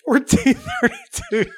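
squeaky high laugh through the guess; laughing while blurting a silly guess. Real, not acted. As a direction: giggle the year out slowly.

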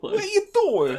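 high, loud, laughing delight; excited greeting. Acted. Player greeting an old friend, high and laughing. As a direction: gush it like you haven't seen them in years.

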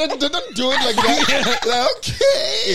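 high, loud laughing plea; cracking up, pleading; laughing protest. Real, not acted. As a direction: laugh while begging them to stop. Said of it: "begging, laughing".

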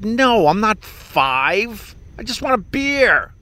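high, slow, put-out refusal; slow, huffy reply to the waiter; mock indignant. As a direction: turn down the offer like it's an insult. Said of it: "And I'm like".

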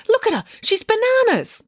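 very high, scornful jeer; scornful exclamation. A narrator's reading. Matilda mocks frantic April.

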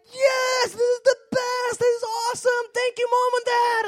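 very high, loud, shouting with joy; a kid yelling in delight from the manure pile; excited boy. Acted. Excited boy shouting thanks to his mom and dad. Overjoyed boy voiced in story.

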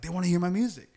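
excited. Retold or imitated. Retelling telling Erica, excited.

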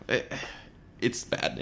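whispered it's bad. Podcast host judging the bad movie, whispered. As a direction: breathe it out as a hushed aside. Repeats verdict, first whispered.

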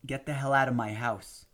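plain reading voice

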